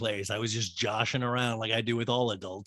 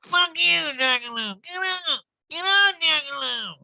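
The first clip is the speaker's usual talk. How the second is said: very high, hammed-up screech; over-the-top shrieked insult; mock drunken yell; 5 dB louder than usual. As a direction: scream abuse like a caricature drunk. Recapper mimics Erica yelling at kid.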